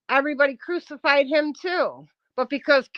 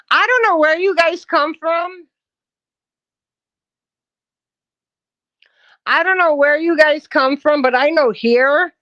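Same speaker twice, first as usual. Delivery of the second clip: loud, emphatic rant; heated insistence; 3 semitones higher, 7 dB louder than usual. Real, not acted. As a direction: push it out loudly, indignant. Angry rant on a live stream.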